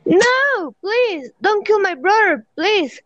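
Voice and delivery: high-pitched, alarmed plea; pleading. Acted. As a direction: beg for your brother's life, pitched well up. Begging demon for brother's life.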